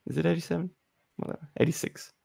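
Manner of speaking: quiet aside; muttering. Real, not acted. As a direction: mutter it to yourself. Muttering to self.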